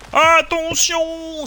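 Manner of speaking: high, loud, long-drawn warning; warning shout. Real, not acted. Player spotting a rabbit below, high drawn-out warning shout. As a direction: cry out high and stretch the word.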